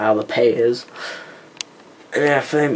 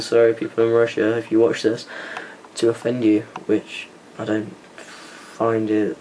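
usual speaking voice

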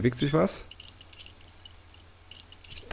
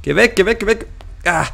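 high, loud frightened yelling; panicked pleading; 8 semitones higher, 4 dB louder than usual. Real, not acted. Gamer trapped by the librarian, yelling in panic. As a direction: yell it in real panic. Blocked by monster.

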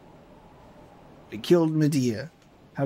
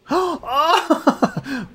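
shocked gasp; 10 semitones higher, 4 dB louder than usual. Real, not acted. Shocked during fight.

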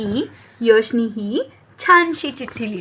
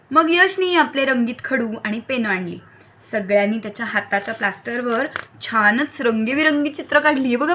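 ordinary delivery, nothing marked

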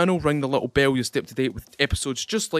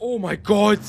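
higher-pitched shocked exclamation; real fear; 6 semitones higher, 3 dB louder than usual. Real, not acted. Player just after an in-game scare, frightened exclamation. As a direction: blurt it out, still panicked from the scare. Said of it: "Did you hear the fear in my voice".

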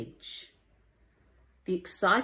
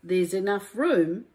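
lively, sing-song rhyming storybook read; reading to children; 5 semitones higher, 6 dB louder than usual. A narrator's reading. Teacher reading a rhyming picture book to children, lively and sing-song. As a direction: bring each rhyme to life for little listeners.

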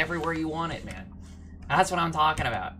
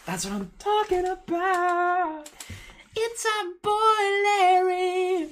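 very high, slow, stretched-out cheer; overjoyed screech; slower than usual. Real, not acted. Gamer cheering for his boy Larry, overjoyed screech. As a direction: draw each phrase out in a high squeal.

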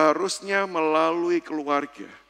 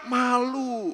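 sheepish admission; 6 semitones higher than usual. Retold or imitated. Wife sheepishly admits reason.

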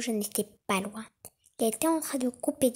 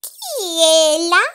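put-on slow, much louder quavering old lady; old grandmother; 6 semitones higher, 12 dB louder, much slower than usual. A narrator's reading. Grandmother calls from her bed, slow old voice. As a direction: call out like a bedridden granny. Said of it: "demanda la vieille dame".

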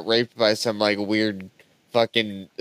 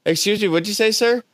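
higher and higher with each question; suspicious tourist; 9 semitones higher, much faster than usual. Pretend. Tourist rising in pitch as he questions the host.

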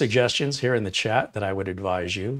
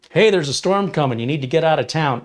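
loud, higher urgent warning; 6 semitones higher, 7 dB louder than usual. Acted. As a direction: shout the warning to get them out. Acted warning in parable.

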